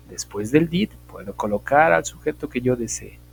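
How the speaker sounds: ordinary talk, nothing marked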